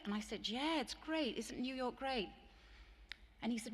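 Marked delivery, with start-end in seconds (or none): 0.5-2.3 higher, breezy self-mocking cheer; lightly hammed-up naive gush about New York; naive enthusiasm. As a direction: play your younger self, gushing innocently. Her naive past self, retold.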